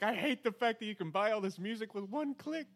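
high, loud frustrated rant; exasperated outburst. Retold or imitated. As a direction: blurt it out in exasperation. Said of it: "I was like".

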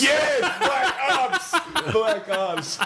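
high, slow, laughing announcement; excited echo. Real, not acted. Cheering a friend's joke, high laughing echo. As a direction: savour the title like a trailer.

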